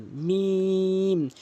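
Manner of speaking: মিম with a long held vowel, lips closing; mim demo. Shown as a demonstration. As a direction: stretch মিম out slowly to closed lips. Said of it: "এভাবে উচ্চারণ". (মিম দুই ঠোঁট মিলিয়ে লম্বা টেনে)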